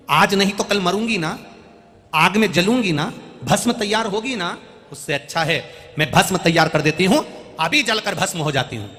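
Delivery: loud, earnest pleading as the wife; insistent wife. Acted. Wife offering herself for the ash, insistent and loud. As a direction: press the offer with rising force.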